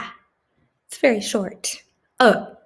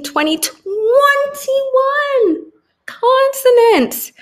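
drawn-out high, louder climax on the total; big dramatic finish; 4 dB louder, much slower than usual. Acted. Teacher to young pupils, slow high dramatic count. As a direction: stretch it out slowly for effect.

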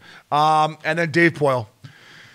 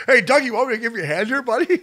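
mock helpful offer; 8 semitones higher than usual. Voicing an offer to the trainer.

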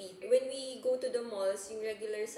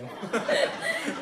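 breathy laugh; 10 dB louder than usual. Real, not acted. Laughing at her own pun.

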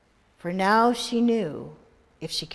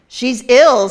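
loud, firm pronouncement; doctor's verdict; 3 semitones higher, 12 dB louder than usual. Acted. The doctor pronounces Vivian ill, loud and firm. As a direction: declare it loudly.

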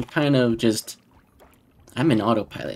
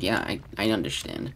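flat, quiet, seemingly uncaring; deadpan sarcasm; 4 semitones lower, 6 dB quieter than usual. Retold or imitated. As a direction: say it like you could not care less. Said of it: "A lot of my personality is just like".

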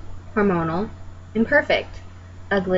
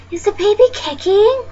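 high, loud, cooing question; eager questioner; 10 semitones higher, 5 dB louder than usual. Acted. Someone asking about the unborn baby, eagerly high-pitched. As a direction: ask it in a high, nosy-stranger voice. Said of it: "you get asked".

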